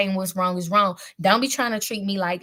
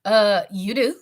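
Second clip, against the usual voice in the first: laughing; 2 semitones higher, 5 dB louder than usual. Retold or imitated. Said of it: "King Kane was like".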